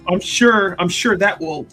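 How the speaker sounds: ordinary talk, nothing marked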